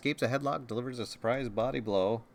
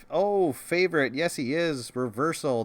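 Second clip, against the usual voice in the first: excited; 5 semitones higher, 6 dB louder than usual. Real, not acted. Big swing in tabletop match.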